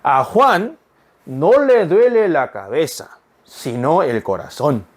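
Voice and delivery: very slow, careful Spanish sentence; slow teacher read-aloud; slow model reading. Shown as a demonstration. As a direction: read it slowly so students can follow. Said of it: "두 번째 읽어보겠습니다".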